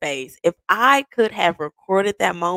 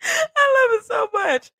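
tearful cry. Real, not acted. Overwhelmed while recounting the proposal.

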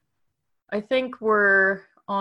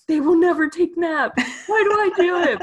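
higher and louder, laughing through it; laughing exasperation; 11 semitones higher, 8 dB louder than usual. Real, not acted. Mom laughing about kids who never nap, louder and higher. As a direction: laugh at your own hopeless scheme. Laughing at own futile plan.

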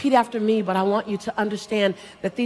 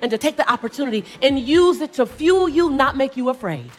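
raised, driving motivational cadence; impassioned preaching; 4 semitones higher, 5 dB louder than usual. Real, not acted. Speaker urging the audience to climb over fears, impassioned preaching. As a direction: build each repeated phrase like a preacher.

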